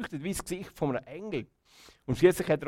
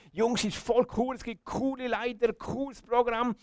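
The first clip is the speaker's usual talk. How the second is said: high-pitched, excited sales pitch; eager enthusiasm; 8 semitones higher than usual. Acted. Child thinking of inviting friends to Jungschi, eager enthusiasm. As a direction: gush about it like an eager kid. Imagined eager thought about youth group.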